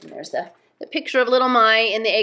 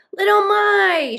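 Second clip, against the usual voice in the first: high, long drawn-out cry; loud, stretched, elated call; overjoyed shout; 14 semitones higher, 8 dB louder, slower than usual. A narrator's reading. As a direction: shout the name with joyful astonishment. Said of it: "shouted Moomin Troll with his mouthful of snow".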